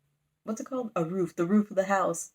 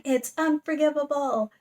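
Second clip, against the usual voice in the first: high-pitched mock-heroic outrage; shifted personality; 8 semitones higher, 4 dB louder than usual. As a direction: declare it high, with overblown indignation. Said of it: "shift personalities".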